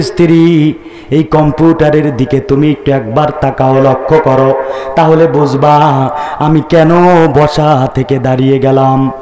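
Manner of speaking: low, level chanted reply; solemn doctor. Acted. Doctor telling wife to look at the screen, solemn. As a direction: drop deep and intone it gravely.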